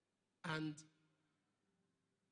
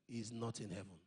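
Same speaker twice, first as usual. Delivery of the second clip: the Lord speaking; 8 semitones lower than usual. Retold or imitated.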